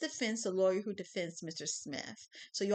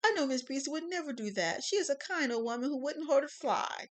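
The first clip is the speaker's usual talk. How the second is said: upset defendant; 6 semitones higher, 5 dB louder than usual. Acted. Smith defends witness.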